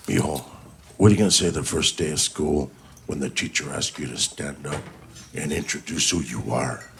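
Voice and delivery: low, quiet, grave elder; militant Chicano uncle. Acted. Uncle coaching his nephew before school, low and solemn. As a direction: speak low and softly as the serious uncle.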